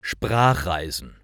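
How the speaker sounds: plain reading voice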